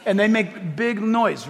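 normal talking voice

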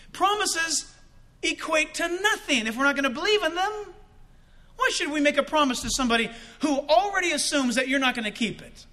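ordinary talk

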